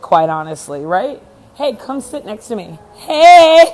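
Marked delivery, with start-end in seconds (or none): 3.0-3.6 high, loud, real shout of hello; excited greeting. Real, not acted. Host welcoming Elizabeth to come sit next to her, excited. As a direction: call out gleefully, high and loud. Calling out to an arriving friend.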